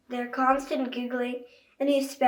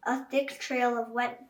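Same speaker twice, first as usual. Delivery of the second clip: ordinary delivery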